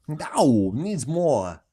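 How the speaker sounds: swooping, halting actor impression; hammed-up celebrity voice with stretched words; Christopher Walken. Riffing on the cowbell sketch, hammed-up actor impression. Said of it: "that's not Christopher Walken".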